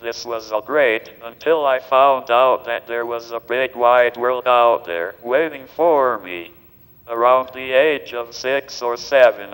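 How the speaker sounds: synthetic text-to-speech voice; digitized voice. Real, not acted. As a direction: speak like a computer speech device. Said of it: "this digitized voice just does not seem to quite".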